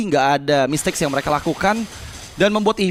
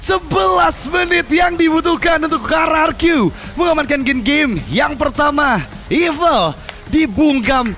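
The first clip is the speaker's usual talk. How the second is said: high, slow, drawn-out victory call; fighting game announcer; 8 semitones higher, slower than usual. Real, not acted. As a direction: slowly savor the victory. Caster announces the win.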